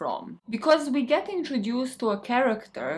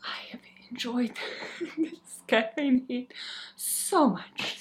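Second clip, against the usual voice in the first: breathless whisper after exercise; out of breath; 3 semitones higher, 5 dB quieter than usual. Real, not acted. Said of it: "Now I'm out of breath".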